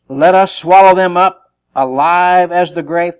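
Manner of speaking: loud, slow, emphatic reading; forceful scripture reading. Acted. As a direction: proclaim the verse slowly and heavily.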